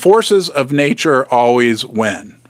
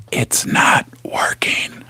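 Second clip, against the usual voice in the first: mock whisper; 5 dB quieter, slower than usual. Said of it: "answers Joe's whisper mockingly".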